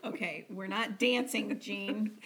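ordinary delivery

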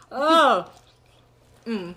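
ordinary delivery

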